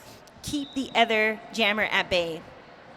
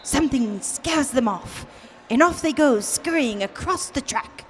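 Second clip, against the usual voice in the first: nature documentary. Pretend. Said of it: "commentate like we are David Attenborough".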